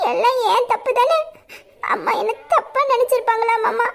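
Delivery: frightened and tearful, apologizing; crying apology. Acted. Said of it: "எதுக்குடி அழுகுற". (அழுதுகொண்டே பயத்துடன் பேசுதல்)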